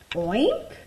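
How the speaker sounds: light piggy oink with a rising end; lightly voiced pig oink. Pig character speaks in story.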